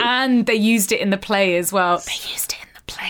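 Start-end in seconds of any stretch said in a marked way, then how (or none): 2.0-3.1 soft, breath-only whisper; whispered repeat. Real, not acted. Co-host repeating the detail about the play, whispered. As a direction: whisper it as a hushed aside.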